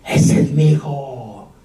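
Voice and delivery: a hint of a proud dad; proud father. Padre defiende al hijo. (padre orgulloso, voz más grave y lenta)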